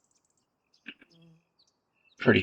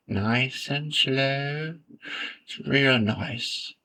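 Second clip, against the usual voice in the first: drawn-out, higher painter voice, lightly put on; Bob Ross; 4 semitones higher, 3 dB quieter, much slower than usual. Gamer mimics a TV painter while drawing a road, very slow impersonation. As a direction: stretch it right out like a soothing TV painter. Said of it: "trying to do my Bob Ross impersonation".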